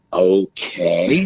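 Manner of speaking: a very low, sceptical "Okay." that drops far below the first one; low dry "Okay." that says "sure you are"; deadpan okay. Retold or imitated. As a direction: play it flat and unimpressed, as if doubting the claim. Reply to "I'm a medium.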